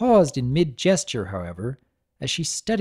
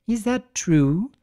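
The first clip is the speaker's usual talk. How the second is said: suspicious lady voice; 7 semitones higher, slower than usual. Acted. Rarity's suspicious question.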